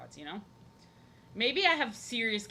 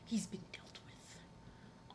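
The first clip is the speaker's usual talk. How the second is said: slow, breathy whispered repeat; 12 dB quieter, much slower than usual. Real, not acted. Streamer quietly repeating that the cat's worms are gone, whispered. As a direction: whisper it slowly to yourself.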